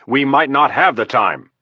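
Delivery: frustrated general snapping back; snapped. Acted. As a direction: cut in sharply. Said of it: "snapped Flood".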